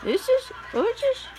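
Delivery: usual speaking voice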